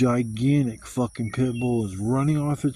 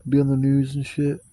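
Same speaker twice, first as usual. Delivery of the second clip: ordinary talk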